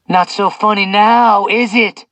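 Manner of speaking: gloating yell over screaming; shouting. A narrator's reading. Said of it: "he shouted over Zeus's screams".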